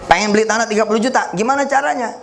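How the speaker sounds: quick high question; fast, lightly high puzzled asker; fast questioner. Retold or imitated. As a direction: rattle it off like an eager asker.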